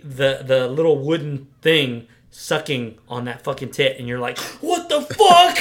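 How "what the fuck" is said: loud, high-pitched acted outburst; horrified shock. Viewer seeing the wooden thing suckle, horrified shock.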